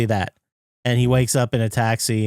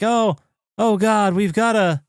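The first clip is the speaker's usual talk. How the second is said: higher-pitched mock panic; retold freak-out; 9 semitones higher than usual. Secretary freaking out at the video phone, mock panic. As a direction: imitate a panicked assistant, pitched up. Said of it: "she's freaking out".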